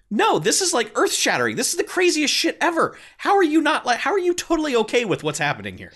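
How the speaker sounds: high-pitched, exasperated disbelief; incredulous outburst. Acted. Baffled viewer challenging the film's calm characters, incredulous.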